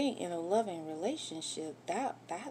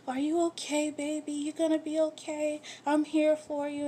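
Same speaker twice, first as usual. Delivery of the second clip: lightly voiced, higher caring coo; mock caring wife; 9 semitones higher than usual. Wife imitating how women comfort a partner, high mock coo. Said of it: "We wanna hold your hand".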